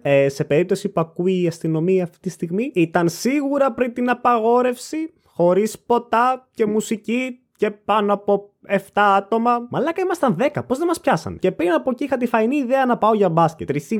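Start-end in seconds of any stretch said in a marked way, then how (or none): 2.7-9.7 very slow, deliberate delivery; careful mock disclaimer. Telling any listening police about the party, slow careful disclaimer. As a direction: spell it out slowly for the record.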